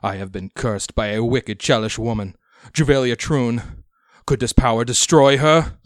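loud, rising, high-pitched plea; desperate demand. Acted. Cursed captain demands.

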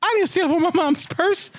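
put-on high-pitched kid protest; Timmy Turner. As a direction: object like a cartoon boy. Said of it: "And he's like".

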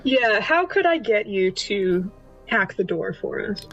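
ordinary talk

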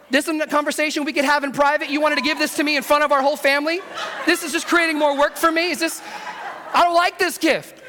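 rising hammed-up indignation, played for laughs; indignant mock complaint. Husband objecting to his wife's embarrassing gift, comically aggrieved. Acted complaint about nose hair trimmer.

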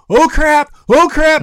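high, loud alarmed yelp, not a real scream; imitating a pilot losing his plane, yelping; panicked oh crap. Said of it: "I remember him going".

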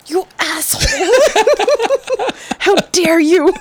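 high, laughing fake fury at the husband; hammed-up accusation through giggles; mock outrage. Woman retelling how she woke her husband after a dream, in mock outrage. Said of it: "I woke him up and I was like".

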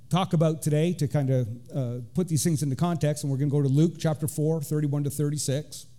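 ordinary talk, nothing marked

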